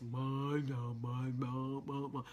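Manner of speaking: hushed star-struck murmuring; awed murmur. Retold or imitated. Crowd on the lot, imitated soft murmur. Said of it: "I hear everybody like this".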